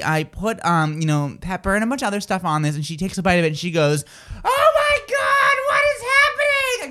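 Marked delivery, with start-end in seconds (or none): 4.4-6.9 squeaky, very high, slow hammed-up panic; mock panicked friend. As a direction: play the friend freaking out over spice, way up high. Friend reacting to spicy chicken.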